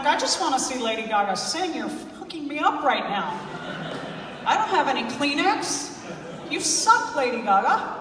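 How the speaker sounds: loud, exasperated comic complaint; mock outrage. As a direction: protest at the film for upsetting you. Acted complaint during sad movie.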